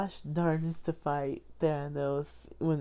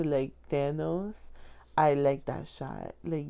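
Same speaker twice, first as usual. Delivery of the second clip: ordinary talk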